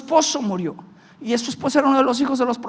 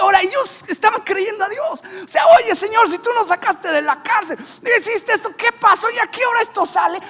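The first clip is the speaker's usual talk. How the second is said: pleading prayer; 10 semitones higher, 4 dB louder than usual. Retold or imitated. Family praying to God voiced.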